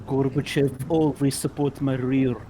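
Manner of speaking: put-on Russian accent. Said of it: "thick Russian accent".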